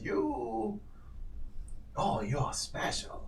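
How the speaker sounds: hint at a secret; sly old man. Acted. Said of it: "he says".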